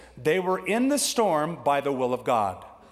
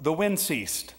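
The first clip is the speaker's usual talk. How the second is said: ordinary talk, nothing marked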